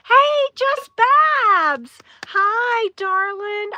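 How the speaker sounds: high, loud, drawn-out hello; delighted greeting. Real, not acted. Streamer spots a missed friend in the chat, delighted greeting.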